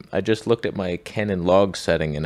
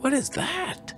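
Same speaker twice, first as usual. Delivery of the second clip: very high, wide-eyed squeak; squeaky excitement; slower than usual. Retold or imitated. Birder glimpsing something new, very high excited squeak. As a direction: squeal the question in delight. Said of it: "it's like".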